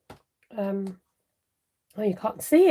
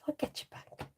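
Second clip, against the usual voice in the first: whispered threat; 12 dB quieter than usual. Retold or imitated. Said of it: "she says".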